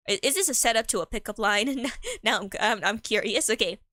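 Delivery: usual speaking voice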